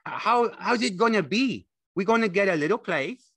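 high, loud, excited simple fellow; Lenny, eager and childlike. A narrator's reading. Lenny asking George about their little place, high and eager. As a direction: ask like an excited big child.

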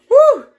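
very high, loud celebratory whoop; loud woo. Real, not acted. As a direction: let out a joyful cheer. Celebrating the winner.

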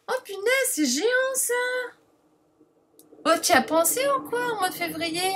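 high-pitched thrilled exclamation; real excitement. Real, not acted. Vlogger thrilled by orange and lemon papers, excited. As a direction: gush in delight, pitch soaring.

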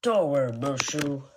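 plain reading voice

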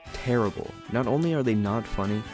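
normal talking voice

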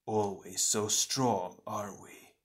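slow, sardonic taunt; slow mockery that drops away; mocking shadow twin. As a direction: draw it out, sneering quietly. Shadow twin mocks hero.

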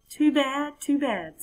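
high, dismissive sing-song; smug frog. Acted. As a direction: brush it off with smug pity.

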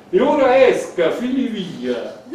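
high, loud command, then slow; dismissive order. Acted. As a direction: order him out of the room. Elisabetta throws Gerard out. (ordine sprezzante e alto)

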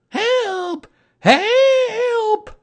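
very high, loud squeal of terror; Piglet crying out. A narrator's reading. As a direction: scream for help as a tiny panicked animal. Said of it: "cried Piglet".

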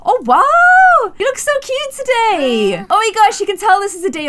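high, loud delighted squeal; excited. Real, not acted. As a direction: gush over the outfit. Delighted at cute outfit.

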